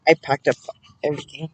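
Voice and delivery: talking through a chip; chewing while talking; mouth full. Real, not acted. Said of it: "had chip in my mouth".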